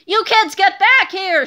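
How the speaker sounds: slow, loud, gruff yell; angry shout. A narrator's reading. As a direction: roar it while chasing them. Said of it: "shouted Mister Krupp".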